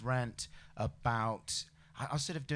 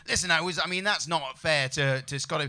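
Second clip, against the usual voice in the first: hurried, slightly raised self-justifying babble; mock-floundering excuse, a bit louder; flustered backpedalling; 3 semitones higher, 8 dB louder than usual. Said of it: "You start qualifying it and saying".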